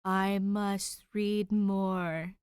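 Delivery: very slow, hungry, drawn-out chant; like a zombie craving the next chapter; mock-possessed slow drone; obsessed reader. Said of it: "I was just like".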